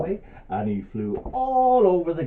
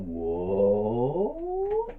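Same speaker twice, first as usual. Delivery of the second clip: swooping whoa. Acted. Storyteller voicing the butterfly's somersault, looping swoop. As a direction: slide the voice through a loop.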